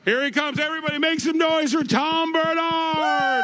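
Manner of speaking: big hype-man crowd call; rally speech. Real, not acted. Hyping crowd for guest arrival.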